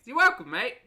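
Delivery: high, loud, hammed-up cheeriness; exaggerated chirpy thanks reply; amped-up cringe. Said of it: "Sometimes I do amp up the cringe".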